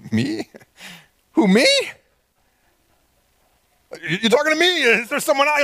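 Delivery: high incredulous who, me; hammed-up disbelief, pitched high; mock surprise. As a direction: act stunned at being picked. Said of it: "they're like".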